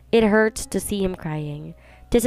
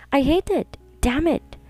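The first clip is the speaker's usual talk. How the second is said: higher, cracking cry of rage; anguished outburst; 7 semitones higher, slower than usual. A narrator's reading. Woman betrayed by her lover, anguished higher outburst. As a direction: let the hurt burst up.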